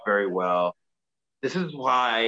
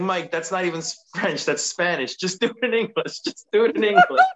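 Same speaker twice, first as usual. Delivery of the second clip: exasperated wife; 7 semitones higher than usual. Retold or imitated. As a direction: imitate a wife begging him to drop it. Retold wife correcting botched French vows.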